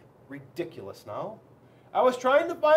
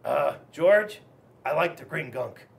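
lightly voiced snail, a bit lower; a hint of a content snail with a full mouth; Melvin the snail; 7 semitones lower than usual. Snail answers George about the gunk, lightly voiced. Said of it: "And Melvin said".

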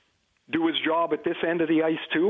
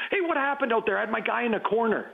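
mock yell; 7 semitones higher than usual. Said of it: "He'd yell down to the forwards".

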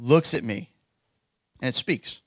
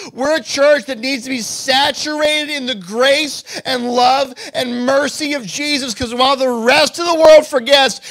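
fervent preaching; 8 semitones higher, 7 dB louder than usual. Acted. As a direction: proclaim it to the congregation with full force. Preaching climax.

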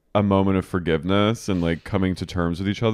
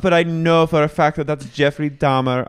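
prim know-it-all; 7 semitones higher, 6 dB louder than usual. Retold or imitated. Whitney quoted correcting.

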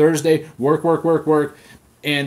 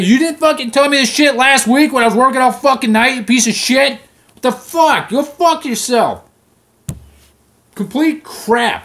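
furious shout, much higher and louder; pissed off; 8 semitones higher, 6 dB louder than usual. Retold or imitated. Overworked engineer yelling at clients, furious shout. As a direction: tear into the clients who moved the deadline. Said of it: "I was fucking pissed".

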